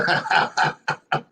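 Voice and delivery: quiet chuckle; a brief wordless chuckle; laugh. Real, not acted. Listener laughs at the remark.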